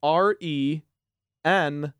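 each letter spelled out slowly; slow letter-by-letter spelling. Shown as a demonstration. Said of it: "Is that not what I said?".

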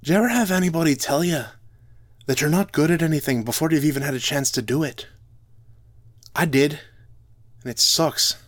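a hint of a hurt dwarf bard; the dwarf bard Drogon, lightly voiced and hurt; Drogon speaking. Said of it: "looked up at me from his character sheet and said".

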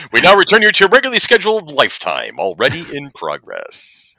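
booming announcer sign-off; old radio announcer. Pretend. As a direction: classic broadcast announcer voice. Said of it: "I can do my own damn voice".

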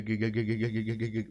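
ordinary talk, nothing marked

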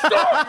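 loud sharp sneeze of the name; name sneezed. Pretend. Sneezing the name in a dusty house. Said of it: "I'll be like".

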